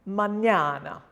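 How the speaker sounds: mañana with a clear "nya" in the middle; Ñ sound. Shown as a demonstration. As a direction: stretch the man-YA-na in mañana. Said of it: "becomes ñ".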